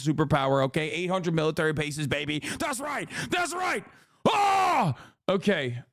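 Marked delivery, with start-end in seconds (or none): 2.6-4.9 rising, pitched-up hype shout; excited yell. Real, not acted. Streamer whipping up chat for America, excited yelling. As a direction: cheer it out, climbing higher each time. Hyped patriotic rant peaks.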